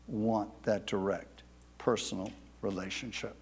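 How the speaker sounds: low, soft, intimate address; hushed appeal. Real, not acted. Preacher asks listeners if they want intimacy with God, hushed. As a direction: drop right down, as if confiding.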